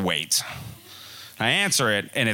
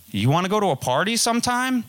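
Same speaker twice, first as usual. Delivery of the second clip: asking her out; 10 semitones higher, faster than usual. Retold or imitated. Asking girl out, voiced high.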